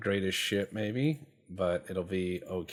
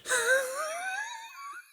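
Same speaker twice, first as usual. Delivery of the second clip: a very high, squeaky burst of laughter; high squeal laugh. Real, not acted. Amused by the bulging turret, high squealing laugh. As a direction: crack up in a shrill squeal.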